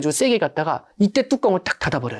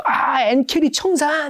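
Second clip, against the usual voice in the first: mock YouTube alarm; 9 semitones higher, much slower than usual. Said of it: "김작가 티비에서도".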